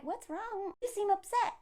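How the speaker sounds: soft, high-pitched gentle inquiry; mock concern. Advising how to respond to ranters, soft mock concern. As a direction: ask very softly with feigned sympathy. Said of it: "or be like".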